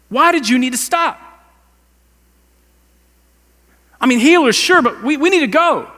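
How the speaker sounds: high, loud exasperated pleading; imagined frustrated Jairus. Retold or imitated. Pastor voicing Jairus's frustration at Jesus, loud and exasperated. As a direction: vent like a desperate father who lost time. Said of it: "but I tell you what I'm thinking".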